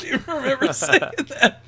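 high-pitched, helpless real laughter; laughing hard. Real, not acted. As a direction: talk through a fit of laughter. Cracking up at retold story.

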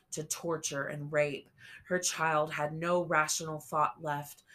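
plain reading voice

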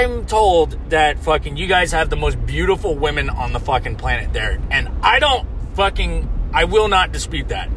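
ordinary talk

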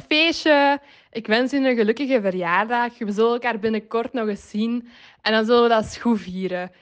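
ordinary delivery

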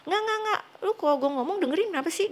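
high, fast, insistent scolding; annoyed brother. Retold or imitated. Retold sibling argument, brother pushing. (kakak yang kesal, nada tinggi dan cepat)

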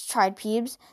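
ordinary delivery

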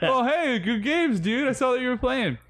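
high, chirpy imitation of a friendly rival; mock friendly gamer. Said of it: "be like".

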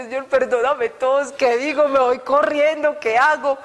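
a flustered, half-joking plea for mercy; mock panicked prayer. Retelling her panic as the woman reached her, a hammed-up frantic plea.

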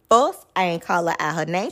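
ordinary talk

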